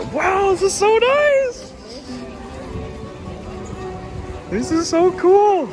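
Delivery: high, thrilled exclamations; excited. Real, not acted. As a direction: gasp with delight at the view. Excited vlogger seeing ride area.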